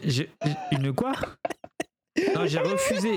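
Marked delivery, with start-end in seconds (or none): 2.3-3.1 laughing. Real, not acted. Denies story, laughing.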